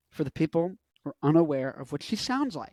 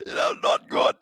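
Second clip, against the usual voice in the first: whispered; 4 dB louder than usual. Real, not acted. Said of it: "That's a bad one".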